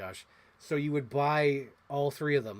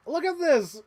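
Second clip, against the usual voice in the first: much higher, loud exclaim; excited; 14 semitones higher, 9 dB louder than usual. Real, not acted. Collector showing viewers a toy, much higher and loud. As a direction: thrust the toy forward, thrilled.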